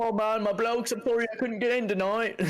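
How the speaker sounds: high-pitched put-on apologetic voice; imitated bandmate. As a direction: mimic an apologetic guy in a high voice. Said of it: "He was like".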